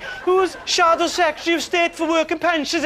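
put-on high, breathy-free girlish question.

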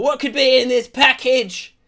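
high, loud storybook wonder; mock-dramatic curiosity; theatrical wondering. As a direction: wonder aloud, hammed up. Acts out own wondering thought.

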